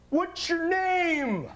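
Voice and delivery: high, slow, drawn-out stranger asking his name. Retold or imitated. Bystander asks the injured man's name, high and slow. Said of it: "And this guy goes".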